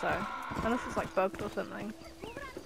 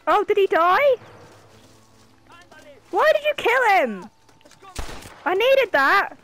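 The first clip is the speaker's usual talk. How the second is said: loud, exasperated complaint; frustrated outburst; 6 semitones higher, 6 dB louder than usual. Real, not acted. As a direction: yell in frustration. Player upset her target died.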